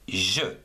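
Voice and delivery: "je" said with a soft zh sound as in French je. Shown as a demonstration. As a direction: sound "je" like the s in measure. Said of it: "on prononce".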